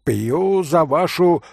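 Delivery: high, slow rousing toast; drunken exclamation to the Polish gentlemen; exclaimed toast. Acted. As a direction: raise the glass and exclaim it. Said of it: "воскликнул Митя".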